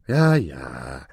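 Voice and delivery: low, dismissive impatience; impatient. A narrator's reading. Aladin cutting off the dwarf's excuses, impatient. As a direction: drop low and brush it off. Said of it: "entgegnete Aladin ungeduldig".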